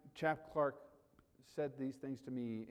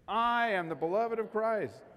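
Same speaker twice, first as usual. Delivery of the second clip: louder, slow, every word weighted: I am the beloved of Christ; emphatic declaration; 6 semitones higher, 6 dB louder than usual. Shown as a demonstration. Pastor leading the congregation in a declaration, louder and slow. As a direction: proclaim I am the beloved of Christ slowly and fully. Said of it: "Let's say it one more time. Look at me".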